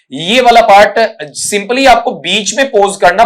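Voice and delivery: ordinary talk, nothing marked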